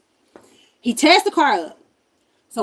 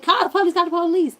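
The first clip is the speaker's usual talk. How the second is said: urgent; 8 semitones higher, 6 dB louder, faster than usual. Retold or imitated. Urgent demand in store.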